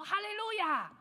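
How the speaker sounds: a pastor calling out praise to his youth; loud hallelujah. Shown as a demonstration. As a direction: call out hallelujah high and loud.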